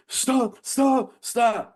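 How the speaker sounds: high, pleading stop over and over; panicked begging. Retold or imitated. Winded bully begging the speaker to quit, high and panicky. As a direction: beg him to stop, voice jumping way up. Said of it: "he's like".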